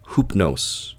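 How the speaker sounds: Hoopnos said with an "oo" first vowel; ancient Attic pronunciation. Shown as a demonstration. Narrator showing listeners the Greek name, Hoopnos said as "HOOP-nohss". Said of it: "in ancient Attic pronunciation is pronounced".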